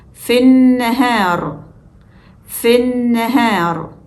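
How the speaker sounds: fin nahar slow, short i into a held n; linked, skipping lam. Shown as a demonstration. Teacher shows learners fin nahar slowly linked, lam silent. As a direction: jump from the f of fin to the n, no l. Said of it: "we jump straight from F to the first N".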